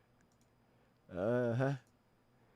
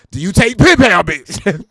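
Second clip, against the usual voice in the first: [name] impression; 3 semitones higher, 10 dB louder than usual. [name] paying at the pump, loud bark. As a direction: snap it at the clerk like [name]. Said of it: "Can you imagine him".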